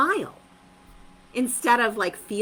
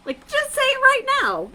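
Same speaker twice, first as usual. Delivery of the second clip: eager urging, much higher; 6 dB louder than usual. Real, not acted.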